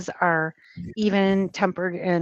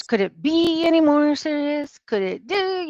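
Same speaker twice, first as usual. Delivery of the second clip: higher, over-stressed comic impersonation; Chandler from Friends; 7 semitones higher than usual. Retold or imitated. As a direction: imitate a sitcom catchphrase, stretching the key verb high. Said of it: "the way he spoke".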